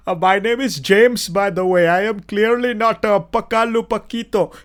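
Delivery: imitation of an Indian man faking English; Indian English accent. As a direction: play a scam caller putting on Indian-accented English. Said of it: "Imagine an Indian guy doing a English accent".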